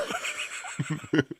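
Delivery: airy wheezing laugh; real breathy giggle; breathless laugh. Real, not acted.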